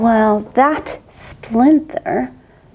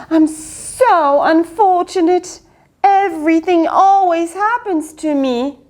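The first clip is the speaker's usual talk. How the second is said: self-pitying whine; 7 semitones higher than usual. Acted. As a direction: whine like poor little me. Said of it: "let's see".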